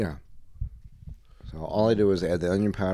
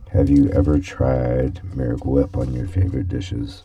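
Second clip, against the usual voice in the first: ad announcer; 6 semitones lower, 5 dB louder than usual. Acted. Mock commercial pitch.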